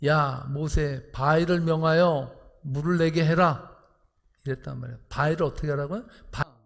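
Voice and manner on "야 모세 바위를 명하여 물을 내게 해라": loud, very slow, weighty command; God commanding. Retold or imitated. God ordering Moses at the rock, commanding. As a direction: proclaim it slowly with full force. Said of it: "이때 하나님께서 어떻게 합니까?".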